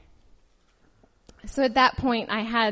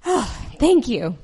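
relieved. Retold or imitated. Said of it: "I was like".

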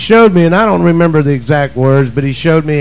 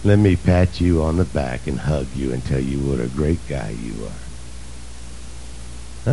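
soothing pity voice; 13 semitones lower, 10 dB quieter than usual. Retold or imitated. God's voice as the preacher imagines it is not, soft mock pity. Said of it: "his voice was not".

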